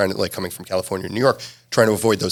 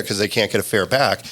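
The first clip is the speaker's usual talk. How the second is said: usual speaking voice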